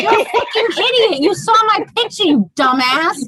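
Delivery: high-pitched, laughing tirade; mock outraged insult. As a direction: chew out a date in mock outrage. Acted telling-off of a date.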